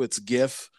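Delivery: gif with a hard G as in gift; world's hard-G gif. Retold or imitated. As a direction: say gif as GHIF. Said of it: "the world is like".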